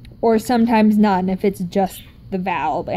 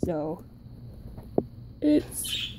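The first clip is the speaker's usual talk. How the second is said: usual speaking voice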